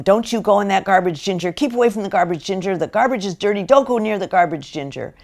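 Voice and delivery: cartoon dog owner nagging the dog; admonishing. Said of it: "he's admonishing his dog".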